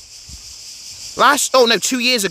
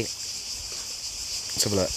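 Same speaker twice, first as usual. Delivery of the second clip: whispering; 8 semitones lower, 13 dB quieter, much slower than usual. Real, not acted. Quiet lead-in before exploring.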